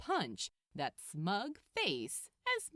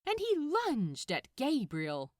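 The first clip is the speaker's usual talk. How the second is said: ordinary delivery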